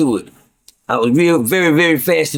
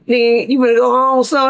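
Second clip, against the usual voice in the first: much higher, teasing put-on voice; mocking high voice; 8 semitones higher than usual. Narrator mocking his own hopeful question to the old man. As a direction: poke fun at the question.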